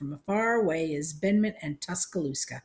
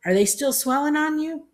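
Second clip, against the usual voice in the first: high, loud, rising question; thundered question, pitched right up; thundering; 11 semitones higher, 7 dB louder than usual. A narrator's reading. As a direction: holler it at an old aunt. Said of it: "Grandma thundered at her".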